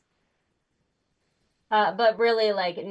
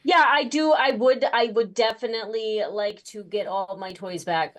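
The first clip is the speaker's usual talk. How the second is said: excited; 4 semitones higher, 6 dB louder than usual. Acted. Character eagerly wanting toys back.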